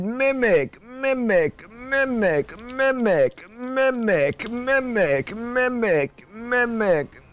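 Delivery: high repeated cries of "Mimic!"; desperate calling. Real, not acted. Gamer calling his summon mid-fight, desperate high cries. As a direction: call out over and over, desperate.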